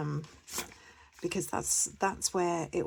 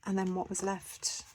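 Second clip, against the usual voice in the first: usual speaking voice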